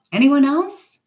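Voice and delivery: high call rising from a boat; yelled question. A narrator's reading. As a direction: call out the question, pitched well up. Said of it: "The person on the boat yelled".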